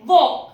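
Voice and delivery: वॉक said as wawk, no L heard; silent L. Shown as a demonstration. Teacher to students, modelling वॉक with a silent L. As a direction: say वॉक cleanly without the L. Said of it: "W A एल के".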